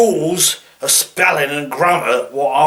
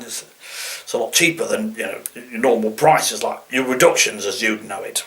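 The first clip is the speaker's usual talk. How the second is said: ordinary talk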